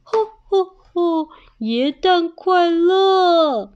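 high, loud ho-ho-ho greeting; jolly Santa. Acted. Santa arriving at the door, jolly and loud. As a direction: draw out a big festive greeting.

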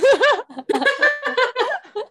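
long high laughing fit; real laughter at a funny phrase. Real, not acted. Laughing at the slang.

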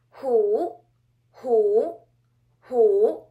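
"hu" loud, dipping then rising; third tone. Shown as a demonstration. Teacher giving the word for tiger, loud dipping and rising third tone. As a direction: project "hu" down and back up. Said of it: "Repeat after me".

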